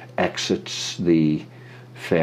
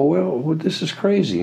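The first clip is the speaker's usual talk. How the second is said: mock amazement; 10 semitones higher than usual. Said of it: "so I come in and I go".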